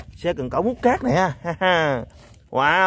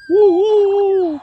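long, high, loud "hoo"; loud sustained high hooting; playful whoop; 13 semitones higher, 6 dB louder, much slower than usual. Pretend. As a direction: let out a high, drawn-out whoop. Whooping after firing toy gun.

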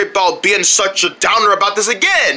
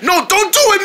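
alarmed protest; 8 semitones higher, faster than usual. Acted. Fish tries to stop puffin.

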